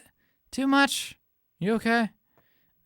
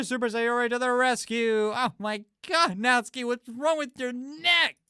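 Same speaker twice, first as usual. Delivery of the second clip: put-on higher, cheerful girl; a hint of a bubbly girl; Sayori, lightly voiced; 8 semitones higher than usual. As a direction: bounce in as a cheerful hero.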